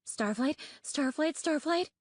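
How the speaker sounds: a frightened friend tugging at him, pitch climbing; panicked whisper. A narrator's reading. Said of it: "whispered in a panicked rush".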